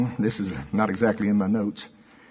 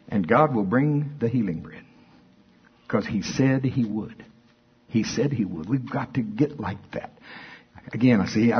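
ordinary talk, nothing marked

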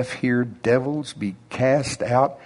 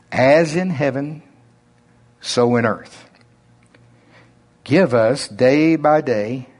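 slow, drawn-out reading with long pauses; unhurried prayer read in his own drawl; slow Oklahoma drawl. Shown as a demonstration. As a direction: let every phrase of the prayer stretch out slowly. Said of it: "in my slow Oklahoma drawl, my normal".

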